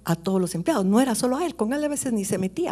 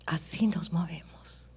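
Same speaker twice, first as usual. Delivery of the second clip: "así nos movemos" echoed in a near whisper, slow; a soft, slow whispered echo of the preacher's own line; hushed whisper; 8 dB quieter, slower than usual. Real, not acted. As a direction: let the repeat sink into a breathy hush. Echoed softly as reflection.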